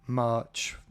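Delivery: ordinary talk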